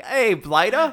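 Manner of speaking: high, loud mock Cockney call; Cockney zoo worker challenging an intruder. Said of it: "Who's Cockney".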